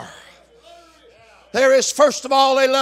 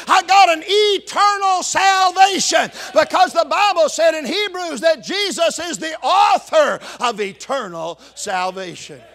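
higher and louder, shouting testimony; full-force preaching; 5 semitones higher, 4 dB louder than usual. Acted. Pastor testifying to his own salvation, shouting triumphantly.

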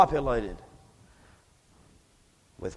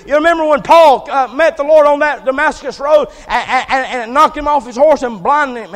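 loud, high, driving sermon delivery; fired-up revival preacher telling a Bible story; full-force preaching; 10 semitones higher, 14 dB louder than usual. Real, not acted. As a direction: preach the story at a near shout.

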